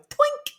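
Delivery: high twinkly ping; cartoon sparkle noise; sound effect. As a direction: chirp it like a tiny magic chime. Sound of wound healing.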